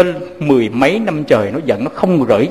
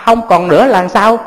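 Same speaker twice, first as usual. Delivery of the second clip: stunned disbelief; 3 semitones higher, 4 dB louder than usual. Acted. Shocked by news of death.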